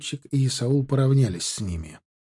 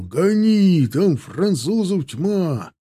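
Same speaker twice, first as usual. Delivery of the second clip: high, drawn-out urgent shout; slow, high-pitched warning cry; barked order; 5 semitones higher, 3 dB louder, much slower than usual. A narrator's reading. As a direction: urge them to flee. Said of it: "рявкнул Исаул".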